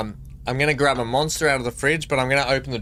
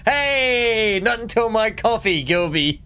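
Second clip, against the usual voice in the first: Janeway impression; 9 semitones higher, slower than usual. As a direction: ham up the captain grumbling for her coffee. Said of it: "how she used to say it".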